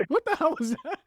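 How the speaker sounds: the crew asking about the improv, high and amused; amused disbelief. Retold or imitated. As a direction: toss it up high, half laughing. Director's amused reaction.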